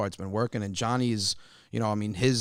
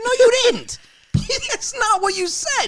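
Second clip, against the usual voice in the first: much higher, loud indignant yell; shouted denial; 8 dB louder than usual. Retold or imitated. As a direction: yell it back, laughing at him. Arguing with father.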